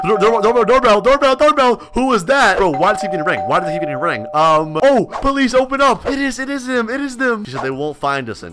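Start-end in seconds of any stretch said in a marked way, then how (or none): 0.0-2.6 loud, higher, building; urgent excited stammer. Real, not acted. Gamer hears doorbell while tied up, excited yelling.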